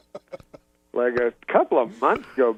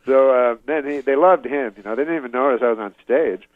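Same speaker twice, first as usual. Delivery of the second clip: usual speaking voice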